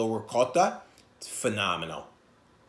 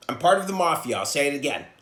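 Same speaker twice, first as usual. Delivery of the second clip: usual speaking voice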